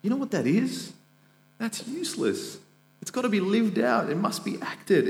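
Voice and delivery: James voiced high and emphatic, a preacher's rebuke; voicing James. James rebukes believers: high, insistent preaching voice. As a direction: press every must like an apostle scolding the church. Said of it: "He says".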